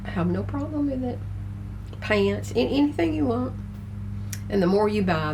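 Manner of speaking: ordinary talk, nothing marked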